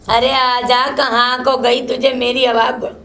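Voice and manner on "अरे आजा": usual speaking voice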